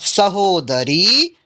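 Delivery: Sahodari drawn out very slowly, each syllable sa-ho-da-ri clear; careful slow pronunciation. Shown as a demonstration. Teacher to students, careful very slow Sahodari. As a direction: spell out Sahodari syllable by syllable for the class. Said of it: "आप लोग उच्चारण पे थोड़ा ध्यान देंगे".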